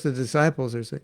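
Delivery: usual speaking voice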